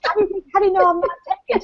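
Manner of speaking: high, fast, playful protest; mock indignant. Nurse teases the matchmaking mother, in a high fast mock protest. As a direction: rattle it off in a high teasing squeal.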